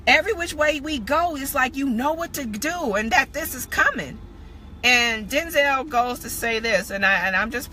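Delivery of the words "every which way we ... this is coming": higher-pitched, lightly voiced wondering girl; girl in the film. Girl asking her rescuer how he knows, lightly acted wonder. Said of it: "She was like".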